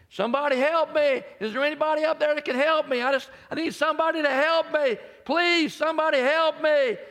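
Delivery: high, loud panicked yelling; desperate scream for help. Retold or imitated. Man dangling from a cliff screams for help, panicked. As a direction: scream up the mountain for rescue. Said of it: "And he starts to scream".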